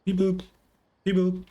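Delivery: squeaky little robot beeping; high robot beeps. Pretend. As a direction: chirp it like a tiny robot. Voicing Marak, high beeps.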